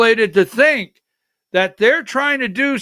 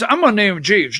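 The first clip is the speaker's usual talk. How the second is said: ordinary talk, nothing marked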